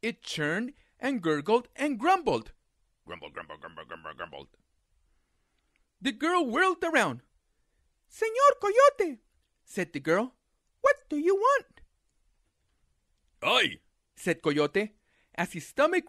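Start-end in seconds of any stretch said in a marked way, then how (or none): 3.0-4.5 low, soft growling grumble; very low gurgling grumble; stomach rumble. A narrator's reading. 8.1-9.2 put-on high, slow little girl; a startled girl calling out, slow and high; high girl's voice. 10.8-11.8 put-on high girl's voice; little girl voice.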